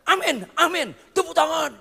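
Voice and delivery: high, loud mocking imitation of a cheering crowd; mock cheering congregation. Preacher mocking a crowd that cheers at sermons, high and loud. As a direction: ham up the showy congregation.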